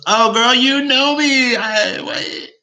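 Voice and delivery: very high, loud drawn-out squeal; gushing mock delight. Student reacting to adviser allowing personality, gushing squeal. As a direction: squeal it like a thrilled best friend.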